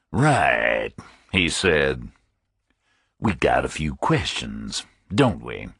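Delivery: a deep, rumbling, unhurried authority; Finley's deep rumble. A narrator's reading. Chief of detectives opening the interview, deep rumble. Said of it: "The voice was deep, like a rumble".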